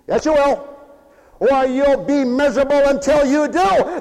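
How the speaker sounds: ordinary talk, nothing marked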